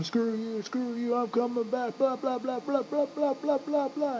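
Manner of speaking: high, soft mocking whine of a protester. Mocking the crowd he imagines, high and soft. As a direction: sneer it like a whiny protester.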